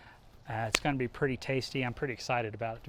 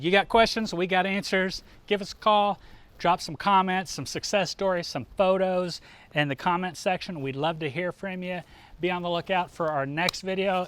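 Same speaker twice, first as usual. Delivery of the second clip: announcer; 5 semitones higher, 3 dB louder than usual. Acted. Live show sign-off plug.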